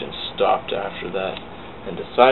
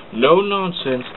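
firm scolding; 7 semitones higher, 3 dB louder, slower than usual. Acted. James scolds the trucks.

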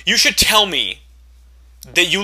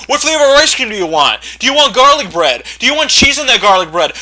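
higher, louder mock yell of the caller; 6 semitones higher, 6 dB louder than usual. Caller yelling questions to his partner, higher and louder. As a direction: yell it off the phone. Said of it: "yelling right in my fucking ear".

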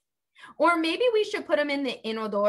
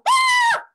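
sudden high, loud mock shriek; 9 dB louder than usual. Teacher playing kids stepping out of the bath, high loud mock shriek. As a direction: yelp as if the water's cold.

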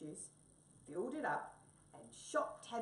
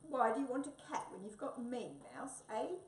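jealous wombat; 7 semitones higher, 5 dB louder than usual. Acted. Jealous Wombat questions Mouse.

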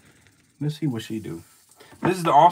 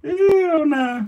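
high, loud shocked outburst; shocked exclamation; 8 dB louder, much slower than usual. Real, not acted. Watching the snake strike, shocked and loud. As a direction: blurt out in shock.

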